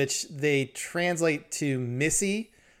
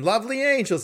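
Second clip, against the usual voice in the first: indignant correction; 10 semitones higher, 4 dB louder than usual. Retold or imitated.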